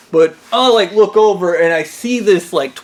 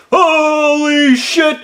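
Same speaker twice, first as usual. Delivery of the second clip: high, drawn-out cry of horror; retold shocked yell; 10 semitones higher, 4 dB louder, much slower than usual. Telling of seeing the creature, horrified yell. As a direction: stretch it out high, rising in mock terror.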